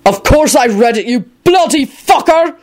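high, exasperated outburst; livid, spitting the words out; murderous fury. A narrator's reading. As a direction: build to a furious high-pitched insult. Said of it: "gave him a more murderous look".

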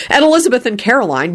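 plain reading voice

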